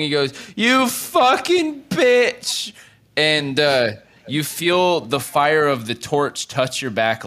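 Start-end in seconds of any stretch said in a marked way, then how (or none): 0.6-2.7 put-on slow, high, louder drunken yell. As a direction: scream it after him as he walks away. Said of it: "of him yelling".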